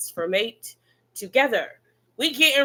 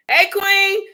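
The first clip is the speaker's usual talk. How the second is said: high, loud, sudden hello; excited greeting; 9 semitones higher, 6 dB louder than usual. Real, not acted. Host spots a fan in the chat, high and loud. Greets a viewer mid-sentence.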